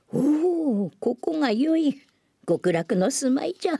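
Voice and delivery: high, joyful exclamation; a pleased old man's cry of delight; delighted old monk. Acted. (嬉しそうな老僧の声)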